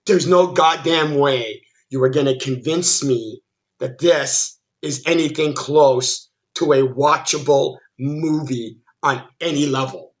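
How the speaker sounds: normal talking voice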